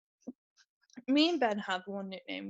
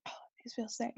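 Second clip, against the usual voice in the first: unvoiced whisper aside; 12 dB quieter, faster than usual. Real, not acted. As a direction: breathe it as a private aside.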